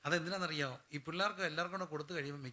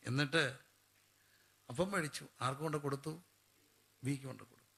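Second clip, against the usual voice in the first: ordinary delivery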